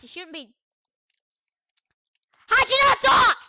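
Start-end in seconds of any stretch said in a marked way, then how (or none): 2.5-3.4 very high loud yell; high shout. Real, not acted. Gamer yelling at a silent player, high shout.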